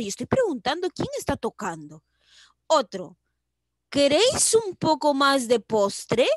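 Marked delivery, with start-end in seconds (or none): 3.9-6.3 loud question lifting on "postre"; questioning tune. Shown as a demonstration. Teacher reading a dessert question example, questioning tune. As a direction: ask about "postre" clearly, projected. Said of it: "O sea, quiere saber".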